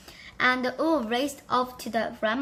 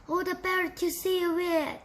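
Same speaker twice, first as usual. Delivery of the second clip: fake granny; 7 semitones higher than usual. Wolf disguised as granny.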